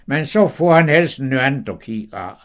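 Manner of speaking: usual speaking voice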